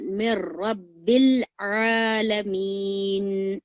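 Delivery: slow recited verse; Quranic recitation. Shown as a demonstration. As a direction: chant the verse slowly and fully. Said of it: "recitation demo".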